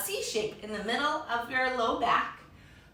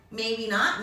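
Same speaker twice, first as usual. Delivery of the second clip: ordinary talk, nothing marked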